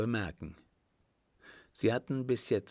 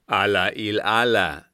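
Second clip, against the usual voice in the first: sheik's loud, startled exclamation; loud cry; 10 dB louder than usual. A narrator's reading. Sheik alarmed by Sadi's suspicion, crying out loudly. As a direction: call it out at full voice. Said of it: "rief er".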